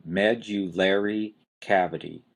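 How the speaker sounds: Medullary, strong "MED", lesser stress on "lar"; first-syllable stress. Shown as a demonstration. Teacher models a hard one to say, first-syllable stress. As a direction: hit the first syllable of Medullary, lighter weight on the third. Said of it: "You've got a strong stress on the first syllable".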